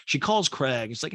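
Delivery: ordinary talk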